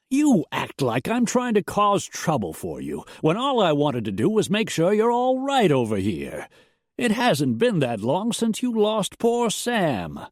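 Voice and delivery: a blustering neighbour playing the injured friend; wounded rancher protesting. A narrator's reading. Elston defends himself to the widow, high and aggrieved.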